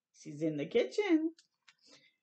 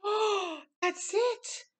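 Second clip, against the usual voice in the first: sudden realization; 11 semitones higher, much slower than usual. Acted. Sudden realization after gasp.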